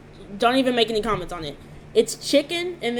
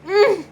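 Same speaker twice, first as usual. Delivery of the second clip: savoring delicious food; 10 semitones higher, 11 dB louder than usual. Real, not acted. Tasting the food.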